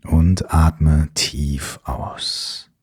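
calm, unhurried breathing cues; guided meditation. Acted.

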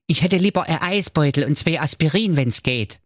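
put-on high, thin old nanny goat; a grateful old goat's voice; frail breathless old person.

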